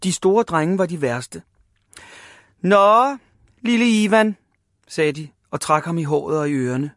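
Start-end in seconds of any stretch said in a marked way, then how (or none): 2.6-4.4 higher, louder mock greeting; teasing. A narrator's reading. Big boys to Ivan before pestering him, teasing. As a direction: needle the little one.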